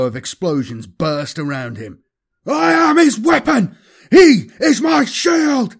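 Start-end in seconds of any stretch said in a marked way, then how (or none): 2.5-5.8 high, loud bellowed battle mantra; bellowing. Acted. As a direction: bellow it, slowing on the shield line. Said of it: "Apollos bellowed the mantra through gritted teeth".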